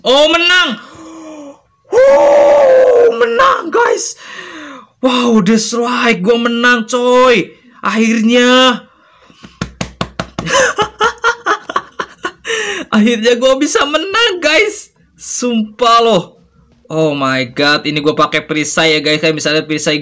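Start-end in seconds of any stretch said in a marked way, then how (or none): 0.1-4.2 high shrieking victory yell; shrieking jackpot winner. Real, not acted. Wins the match, shouts in joy. 5.0-8.9 drawn-out joyful win. Real, not acted. Savoring the win. 9.6-12.9 high shaky laugh near tears; laugh-cry of relief; high laughing sob. Real, not acted. Laughing and crying after win. 13.0-16.2 high elated disbelief. Real, not acted. After first win.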